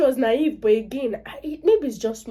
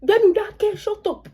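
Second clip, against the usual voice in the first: high, loud mock outburst; indignant telling-off; 12 semitones higher, 7 dB louder than usual. Elder scolds Ife for butting into adult talk, indignant. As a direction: shut the youngster up with raised pitch. Snaps at interrupting youngster.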